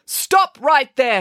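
high, slow, commanding call; firm narrated call to stop. A narrator's reading. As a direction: call a halt, each word drawn out. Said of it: "called Friday".

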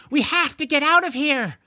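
loud, high urgent plea; urgent George. Acted. George urging Lorne away from the fire, panicked. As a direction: press the point, panicking. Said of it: "George said".

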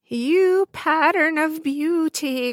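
high, slow sneering witch; mock her beauty cruelly; evil queen. A narrator's reading. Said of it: "cried the wicked queen".

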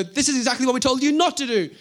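high, loud scolding. Pretend. A judge scolding the apostles in court, furious. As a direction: tell them off like a furious magistrate.

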